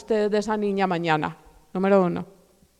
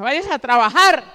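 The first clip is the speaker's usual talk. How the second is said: loud, scolding mother, retold; harsh order; 6 dB louder than usual. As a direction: order the child to work, raising the voice. Mother orders daughter out again. (regaño fuerte de madre, imitado)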